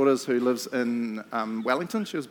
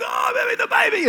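high panicked cry, louder; 5 dB louder than usual. Retold or imitated. Wife on the stairs crying out in alarm, high panicked cry. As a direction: squeal it in alarm. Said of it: "Joseph's like".

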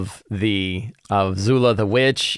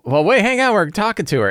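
much higher mock alarm; players hurrying to stop; 10 semitones higher than usual. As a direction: squeak out a quick objection.

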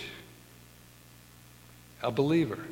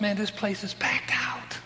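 whispered aside; 6 semitones higher than usual. Retold or imitated. Dan urging they go early.

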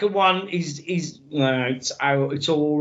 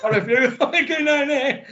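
higher voice breaking into laughter; laughing mock refusal; 7 semitones higher than usual. Joking as a henchman who won't go down there, laughing. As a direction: act the reluctant bloke while cracking up. Mocking film extra, cracking up.

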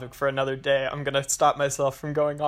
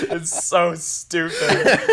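laughing; 8 semitones higher, 4 dB louder, much slower than usual. Real, not acted. Laughing at Transformers accent.

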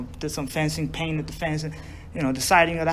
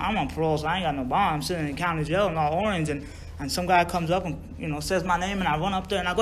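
ordinary talk

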